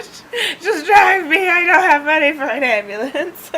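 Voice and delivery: high tearful pleading, half laughing; mock crying. Driver begging a stranger for a ride, mock crying. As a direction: beg in hammed-up sobs. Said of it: "I just start crying".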